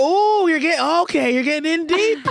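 high, loud teasing "ooh"; mock warning to self. Woman noticing her accent creeping in, mock warning. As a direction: tease yourself for being caught out. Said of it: "I was like".

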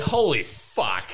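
slow, drawn-out stunned curse; mild mock amazement at the twist; stunned awe. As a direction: relive the jaw-drop. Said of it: "I was like".

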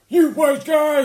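high, loud mock yell; outraged outburst. Imagining Marsh hearing his own impression, mock outraged shout. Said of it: "heard himself and went".

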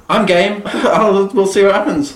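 laughing. Real, not acted. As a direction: say it through the giggles. Laughing agreement, high and loud.

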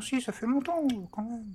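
Trépide's voice. Acted. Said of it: "en faisant cette voix".

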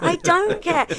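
high, loud I don't care again, pressed harder; mock cat voice. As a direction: keep the Cat's careless tone. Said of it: "And the cat goes".

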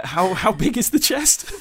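lightly put-on character voice, slow; playing the rogue, hammed a little; overdone cosplay voice. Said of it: "I don't know why I'm doing this in character voice".

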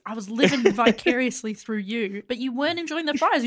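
ordinary delivery

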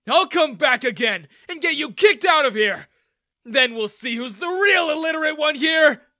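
high, loud shout of rage; enraged full-voiced shout; furious yell. A narrator's reading. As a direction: yell a threat across the street. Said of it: "I yelled at Daniel".

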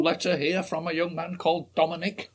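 Jimmy Savile impression. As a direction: announce the letter like a hammed-up Jimmy Savile. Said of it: "really good impression of Jimmy Savile".

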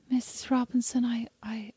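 timid unvoiced whisper. Girl at her friend's mother's door, whispering. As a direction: breathe it out ashamed. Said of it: "knock on the door and go".

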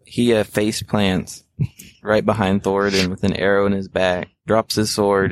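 normal talking voice

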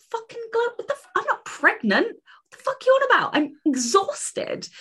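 high-pitched, indignant, disbelieving; incredulous high outburst. Retold or imitated. Retorting to Chloe that she isn't pregnant, high indignant squeal.

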